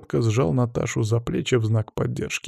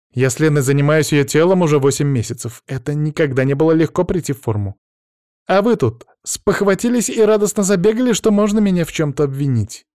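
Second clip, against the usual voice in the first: raised, higher defensive outburst; louder, offended self-defence; indignant; 6 semitones higher, 5 dB louder than usual. Acted. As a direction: build up loud indignation at the accusation. Trainer indignantly defends herself in quarrel.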